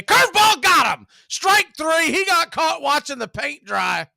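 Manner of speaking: big rising shout on the strikeout; excited strikeout call. Real, not acted. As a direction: explode on the call, loud and high. Strikeout call, bases loaded full count.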